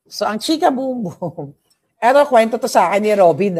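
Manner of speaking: giddy gossip opener; excited gossip. Real, not acted. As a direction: spill the tea with a giggle. Gossip lead-in with a laugh.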